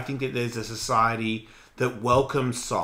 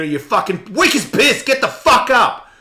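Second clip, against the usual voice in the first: louder, higher drill-sergeant shout; harsh yell; 10 semitones higher, 10 dB louder than usual. Retold or imitated. Tough voice telling someone to get up, harsh shout. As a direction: bark it like a tough coach. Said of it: "when people say".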